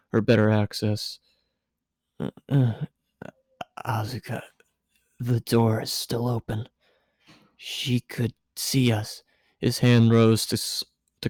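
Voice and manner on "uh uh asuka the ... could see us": soft, nervous hushed protest; flustered hush. A narrator's reading. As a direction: keep it down, caught off guard. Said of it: "Shinji flustered, door open".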